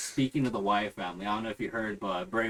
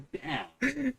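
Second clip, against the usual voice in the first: impressed damn; 12 semitones higher, 3 dB quieter than usual. Retold or imitated. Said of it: "I'm like".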